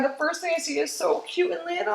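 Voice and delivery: usual speaking voice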